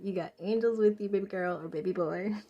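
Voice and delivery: put-on loud, sassy girlfriend hype; big loud bestie pep talk; hyping up a friend. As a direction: cheer her on like your bestie. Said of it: "That's how I talk to my friends".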